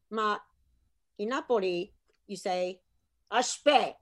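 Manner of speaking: ordinary talk, nothing marked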